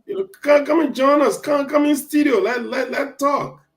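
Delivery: high, loud call for viewers to come join; excited invitation. Real, not acted. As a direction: beckon the audience in eagerly. Calling viewers into studio.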